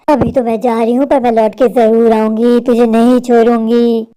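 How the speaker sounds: deep witch voice, slowing into drawn-out threat; menacing witch. Acted. Witch vowing to return for her prey, menacing. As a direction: stretch the final vow low and slow.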